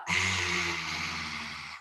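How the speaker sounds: long open-mouthed huffing exhale; lion's breath exhale. Shown as a demonstration. Instructor demos the lion's breath for viewers, long huffing exhale. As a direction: let the breath roar out like a lion. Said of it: "You breathe in, you breathe out".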